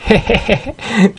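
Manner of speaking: high, gleeful cackle; lecherous villain's chuckle. Acted. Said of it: "mỉm cười".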